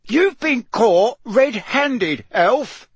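loud, high, slow roar; roared accusation. A narrator's reading. Mister Diggory accuses the elf caught red-handed, roaring. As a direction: hammer it out furiously. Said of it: "Mister Diggory roared".

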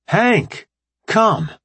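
high, loud call to the dog. Acted. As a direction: call the dog across the room. Calling the dog over.

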